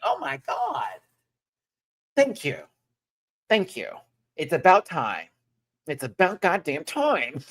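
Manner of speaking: high-pitched, relieved proud-parent gushing. Retold or imitated. Fan thanking a drag queen who finally delivered, proud. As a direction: gush like a parent whose kid finally delivered. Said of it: "I felt like a proud parent".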